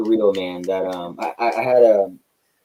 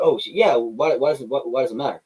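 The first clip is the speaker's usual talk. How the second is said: heel attitude; 6 semitones higher, 3 dB louder than usual. Retold or imitated. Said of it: "go in the kayfabe moment".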